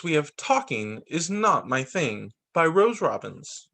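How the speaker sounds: ordinary delivery, nothing marked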